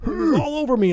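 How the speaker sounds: high, loud, freaked-out cry; panicked rookie. Retold or imitated. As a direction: panic like a rookie just hung up on. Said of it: "And he goes".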